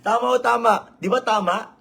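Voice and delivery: ordinary talk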